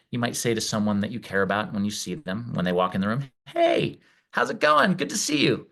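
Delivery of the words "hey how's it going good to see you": bright, much higher welcoming hello; cheerful high greeting to a friend walking in; warm friendly greeting. Shown as a demonstration. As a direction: greet someone you care about, lifting the voice high. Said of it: "you hear your own voice and the prosody in your voice".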